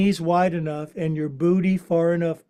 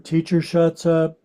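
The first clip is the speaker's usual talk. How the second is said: usual speaking voice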